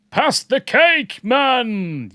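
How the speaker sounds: high, loud call with drawn-out words; playful shouted demand. Pretend. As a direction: holler it out slowly for fun. Playfully calling out for cake.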